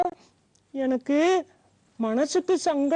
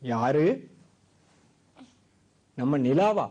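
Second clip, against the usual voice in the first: a much lower, gentle grandpa voice; old grandfather coaxing a crying granddaughter; kind old grandfather; 6 semitones lower than usual. Acted.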